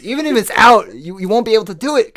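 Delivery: high, loud and fast; rushing, amped-up storytelling; excited. Real, not acted. Excited comic bit.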